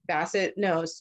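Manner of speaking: normal talking voice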